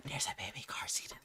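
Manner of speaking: hushed, voiceless mock whisper; barely audible whispering impression; imitated whisper. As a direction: mimic someone whispering a secret find. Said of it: "why is she whispering".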